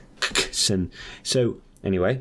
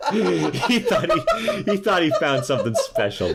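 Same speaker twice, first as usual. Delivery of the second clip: laughing; slower than usual. Real, not acted. Teller cracking up at own story.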